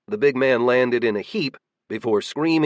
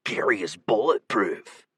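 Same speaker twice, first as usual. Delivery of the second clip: grousing; 2 semitones lower, 4 dB quieter than usual. A narrator's reading. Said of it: "groused the mustachioed shooter".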